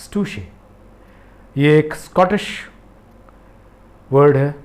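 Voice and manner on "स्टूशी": careful model of स्टूशी, said as stoo-shee; pronunciation demo. Shown as a demonstration. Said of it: "इसका एनंसिएशन ध्यान से सुनिएगा".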